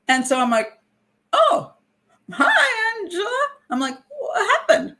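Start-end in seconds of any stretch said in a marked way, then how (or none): 1.3-3.5 high, bright hostess voice, forced cheer; mock-chipper hello to an old acquaintance; fake cheery greeting. Greeting Angela in feigned cheer. 4.2-4.9 high, sing-song concerned voice; high sweet worried question; mock concern.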